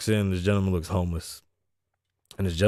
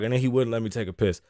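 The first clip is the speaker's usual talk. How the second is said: usual speaking voice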